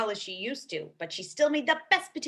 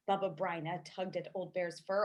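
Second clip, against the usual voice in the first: usual speaking voice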